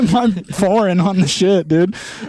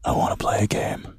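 unvoiced sinister whisper; creepy horror whisper; 8 dB quieter than usual. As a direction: hiss it like a puppet killer. Horror movie quote again.

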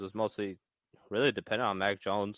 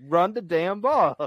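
slow, high, loud demand; exasperated holler down the phone; acted sideline yell; 6 semitones higher, 5 dB louder, slower than usual. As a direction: draw each word out as a mock order. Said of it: "just be like".

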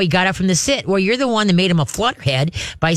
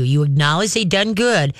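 usual speaking voice

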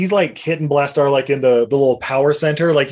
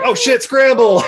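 higher, louder mock panic; panicked yell; 9 semitones higher, 4 dB louder than usual. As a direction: shout it as everyone runs for it. Soldiers flee countdown.

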